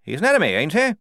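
high, loud, bluff officer's voice; booming jolly commander; hearty major. A narrator's reading. The major greeting the squad, loud and bluff.

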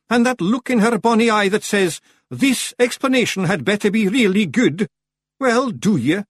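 high, loud, ominous warning; doom-laden Rob Anybody. A narrator's reading. Rob warning Feegles of a wife's look, doom-laden. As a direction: build the threat, pitched well up. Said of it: "in a voice of doom".

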